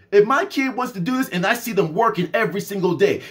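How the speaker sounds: ordinary talk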